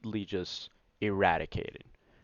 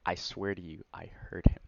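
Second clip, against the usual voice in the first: ordinary talk, nothing marked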